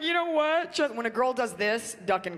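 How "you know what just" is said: put-on huffy girlfriend. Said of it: "I'd be like".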